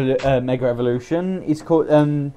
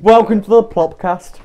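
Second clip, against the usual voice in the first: announcer intro; 5 semitones higher, 6 dB louder than usual. Acted. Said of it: "We've got our name now".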